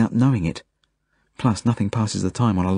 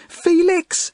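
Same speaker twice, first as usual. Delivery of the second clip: very high, desperate cry of a name; yell; 3 dB louder than usual. A narrator's reading. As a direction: scream out for him, far up in pitch. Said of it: "she yells".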